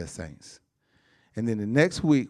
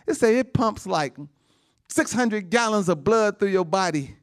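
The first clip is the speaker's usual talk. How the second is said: rousing preaching; 8 semitones higher than usual. Acted. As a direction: make them marvel at God's work. Preacher marvels at the body.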